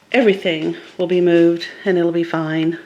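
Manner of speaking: usual speaking voice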